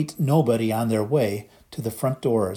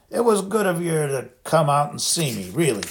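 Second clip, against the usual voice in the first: Hagrid; 5 semitones higher than usual. A narrator's reading.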